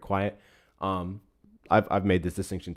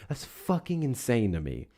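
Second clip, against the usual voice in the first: usual speaking voice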